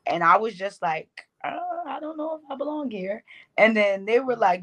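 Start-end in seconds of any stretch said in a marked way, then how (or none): 1.4-3.2 small, high, soft voice; hesitant high-pitched murmur of self-doubt. Retold or imitated. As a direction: act out timid uncertainty, pitched up and quiet. Voicing own past self-doubt.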